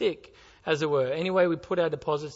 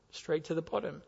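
soft repeat; 8 dB quieter than usual. Shown as a demonstration. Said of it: "and it sinks straight to the bottom".

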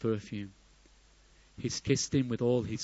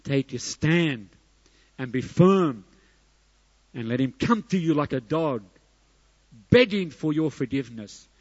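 higher, loud, emphatic sermon delivery; forceful preaching; 3 semitones higher, 7 dB louder than usual. Real, not acted. Preacher describing a stern father's demands, forceful preaching. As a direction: preach it hard, driving each phrase.

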